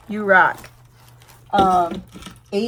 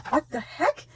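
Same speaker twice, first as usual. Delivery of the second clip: higher, softer amazed question; gasp, then ask it in disbelief; astonished, held back; stunned disbelief; 4 semitones higher, 5 dB quieter than usual. Real, not acted. First stunned reaction to gift.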